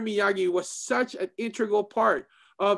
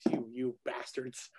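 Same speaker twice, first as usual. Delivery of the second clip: tearful; 9 semitones lower, 13 dB quieter, slower than usual. Real, not acted. Overcome by the season's twist.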